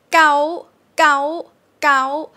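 gao pitched high. Shown as a demonstration. Teacher modelling high-tone gao for students. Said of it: "High tone".

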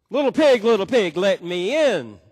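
loud, high mock wolf call. Wolf calling to pigs in brick house, loud and high. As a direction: bellow it up at the brick house door.

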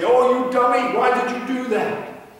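high, loud mocking jeer; bully mocking a child for a mistake; name-calling. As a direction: taunt a kid who messed up. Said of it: "They might say".

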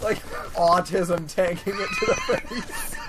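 laughing shout. Real, not acted. Laughing at the game.

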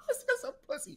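laughing. Real, not acted. Laughing taunt.

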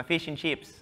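Australian accent, "chips" drawn toward "cheeps"; "fish and chips" with tight Australian ee vowels; Australian twang. Shown as a demonstration. Sticking to his own food, slipping into Australian twang. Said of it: "There was a bit of an Australian twang going on there".

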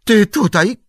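high, loud cry of surprise; startled shout. A narrator's reading. Innkeeper startled by Strider's sudden appearance, shouting. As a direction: yelp it out, caught off guard. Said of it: "Krzyknął".

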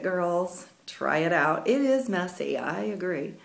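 ordinary talk

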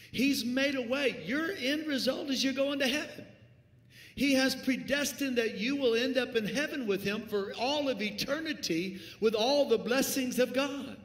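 loud, high, building preacher's cry; rising to a shouted climax; full-force preaching. Real, not acted. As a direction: preach it out at the top of the voice.

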